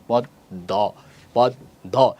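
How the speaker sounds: পদ্ম split as pod, pause, do; doubled d. Shown as a demonstration. Teacher showing that the d comes twice, পদ্ম split into pod-do. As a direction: break পদ্ম between its two d sounds. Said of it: "দুইটা দ হয়ে গেছে".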